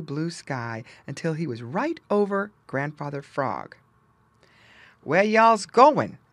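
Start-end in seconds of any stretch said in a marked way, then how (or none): 5.0-6.2 slow, loud Southern drawl, higher. Acted. Ol' Mister Buzzard calls down to the friends in a slow, loud Southern drawl. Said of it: "asked Ol' Mister Buzzard".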